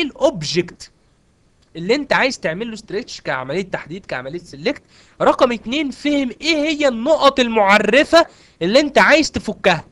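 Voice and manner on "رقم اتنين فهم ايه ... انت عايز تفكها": louder, slow and pressing; worked-up emphasis. Real, not acted. Teacher getting carried away explaining the stretch command, loud and emphatic. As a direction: drive the point home too hard, then catch yourself. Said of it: "لا اهدى كده شويه".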